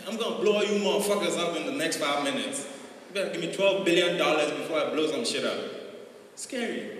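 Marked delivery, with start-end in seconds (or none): 0.1-5.6 higher, louder American movie threat; mock American gangster rattling off his demands; American villain accent. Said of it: "if someone from America calls the U N".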